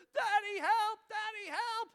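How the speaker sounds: put-on loud, very high panicked child; little girl calling. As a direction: imitate a small child yelling for dad. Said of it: "all of a sudden I hear".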